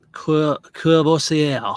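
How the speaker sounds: Courvoisier slowly, "koo-boh-see-eh"; slow French try. Shown as a demonstration. One last slow try at the name for viewers. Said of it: "one more attempt".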